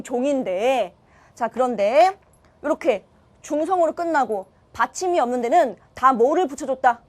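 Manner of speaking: ordinary talk, nothing marked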